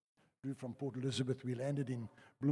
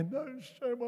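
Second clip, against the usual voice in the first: sympathetic cluck; 10 semitones higher than usual. Retold or imitated. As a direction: coo with pity. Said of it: "sympathetic woman retold".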